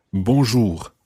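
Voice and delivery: Bonjour ending in a throaty French R; Bonjour, uvular R. Shown as a demonstration. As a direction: finish Bonjour with a guttural R. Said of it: "the R at the end of bonjour is quite tricky".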